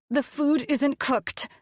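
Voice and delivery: high, slow, upset insistence; rising anger. A narrator's reading. Upset diner to her partner, anger building. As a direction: insist slowly, pitched high, with rage building. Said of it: "my rage growing".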